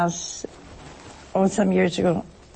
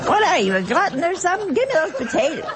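high, laughing mock scolding; playful quarrel over potatoes, pitched higher; mock Italian table banter; 8 semitones higher, faster than usual. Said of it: "somebody would say".

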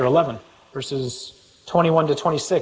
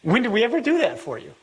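high, quick incredulous question; incredulous protest; 10 semitones higher, faster than usual. Acted. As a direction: protest in baffled disbelief, voice pitched up. Puzzled listeners questioning the judge.

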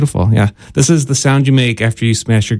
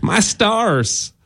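higher southern-belle style exclamation; southern accent; 7 semitones higher, 3 dB quieter than usual. Shown as a demonstration. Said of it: "only say that with a southern accent".

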